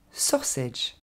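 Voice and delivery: SAUSAGE as "SAW-sedge"; stressed SAW, short falling "sedge" in SAUSAGE; correct English pronunciation. Shown as a demonstration. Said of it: "va se dire".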